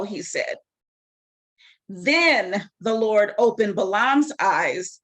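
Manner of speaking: usual speaking voice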